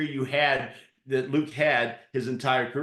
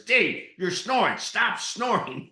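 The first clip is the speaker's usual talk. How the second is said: annoyed complaint; 8 semitones higher, 5 dB louder, slower than usual. Retold or imitated. Roommate complains about snoring.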